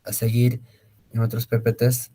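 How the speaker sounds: usual speaking voice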